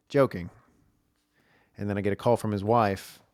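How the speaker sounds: ordinary talk, nothing marked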